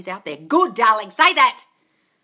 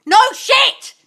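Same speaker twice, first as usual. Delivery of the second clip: higher, louder No shit! tossed out; 10 semitones higher, 8 dB louder than usual. Pretend. Answering a client on a call, loud No shit. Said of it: "And I'll go".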